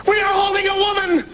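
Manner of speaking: high, thrilled yelling; excited shouting. Acted. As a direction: call out in giddy excitement.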